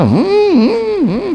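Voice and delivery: high monkey "hmm" noises; imitated monkey hum. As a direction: mimic the monkey's high-pitched refusal. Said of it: "फिर कर रहा था". (बंदर की नकल में ऊँची हम्म)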